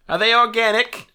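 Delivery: high, loud woman customer; middle-aged woman voice. Acted. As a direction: pitch it up as a pushy lady shopper. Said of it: "Middle-aged woman walks up".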